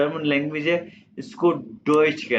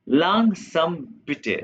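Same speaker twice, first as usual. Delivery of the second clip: langsam bitte drawn out very slowly; slow demo; 2 semitones higher, much slower than usual. Shown as a demonstration. Teacher models asking to slow down, very slowly. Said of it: "आपने मुझे कहना है".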